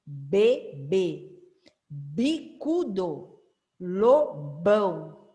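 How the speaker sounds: each word read slowly, syllable by syllable; teacher reading a word list aloud; slow reading drill. Shown as a demonstration. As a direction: model the words clearly for children to repeat. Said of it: "Vamos treinar aqui".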